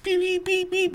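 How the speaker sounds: high-pitched mimicked bleeps; censor beep imitation. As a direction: beep like a TV censor over the swearing. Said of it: "He censored himself".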